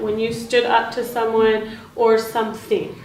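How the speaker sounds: ordinary talk, nothing marked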